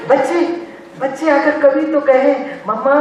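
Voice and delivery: lightly acted, high-pitched wistful appeal; acted longing appeal. Speaker imagining children thanking their mother, lightly acted longing appeal. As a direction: lift it high as if wishing aloud.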